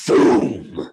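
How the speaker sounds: boom. Acted. Explosion sound in fight scene.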